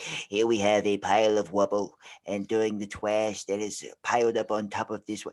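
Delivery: low, measured mock-English documentary voice; BBC English accent. Shown as a demonstration. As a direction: imitate an elegant BBC English presenter. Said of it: "a dude comes on for the B B C".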